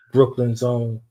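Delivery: ordinary talk, nothing marked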